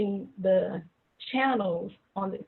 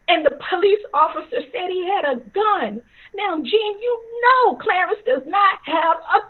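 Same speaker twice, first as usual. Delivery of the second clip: raised, anguished protest, building higher; angry yelling; 7 semitones higher, 5 dB louder than usual. Retold or imitated. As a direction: act an outraged father, climbing in pitch and force. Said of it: "my dad was yelling".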